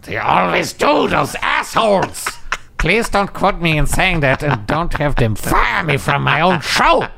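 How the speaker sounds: high cartoon voice protesting; cartoon character rant. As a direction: squeal it like an indignant cartoon. Voicing a cartoon character's complaint.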